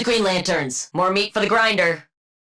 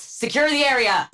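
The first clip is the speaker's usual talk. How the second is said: usual speaking voice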